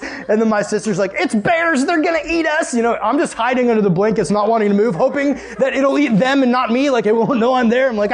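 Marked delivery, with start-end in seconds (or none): 1.1-2.7 high-pitched mock hysteria; panicked sister. Sister warning the family in the camper, mock panic. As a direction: squeal out the warning, hammed up.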